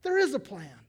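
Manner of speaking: high, loud, amazed gasp of realization with a laugh; high, loud delighted wow; amazed discovery. Retold or imitated. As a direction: play it as Naomi suddenly seeing the plan. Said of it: "All of a sudden, she's like".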